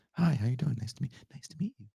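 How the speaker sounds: small, quiet, hurried hello; soft polite greeting. Retold or imitated. Imitated host meeting a fan, quiet and polite. Her real manner, contrasting the yell.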